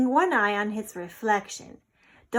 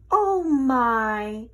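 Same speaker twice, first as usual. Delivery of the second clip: playful fake alarm, loud; mock dismay; 3 semitones higher, 8 dB louder than usual. A narrator's reading. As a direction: pretend to be shocked. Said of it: "she said with mock dismay".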